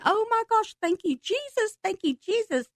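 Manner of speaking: very high, thrilled thanks; joyful crying out. Retold or imitated. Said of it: "I'm jumping up and down, tears crying".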